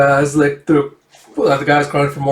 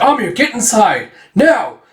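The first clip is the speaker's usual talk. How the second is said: high, raised order to get to safety; acted urgency, pitched up; urgent command; 8 semitones higher, 4 dB louder, slower than usual. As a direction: send her away from danger. Rescuer orders her to safety.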